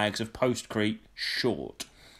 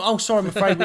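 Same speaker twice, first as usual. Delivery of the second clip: polite customer service; 7 semitones higher, 4 dB louder, faster than usual. Shown as a demonstration. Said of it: "But not".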